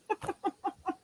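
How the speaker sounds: a short squeaky laugh breaks out; high laugh. Real, not acted. Host laughs to viewers about her birth year, a short high laugh. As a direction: laugh at giving her age away.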